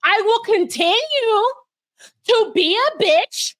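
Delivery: mock villain mother. Mocking Chevelle's mom defying everyone, hammed-up defiance. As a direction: ham up a proud petty mother. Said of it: "Chevelle's mother is like".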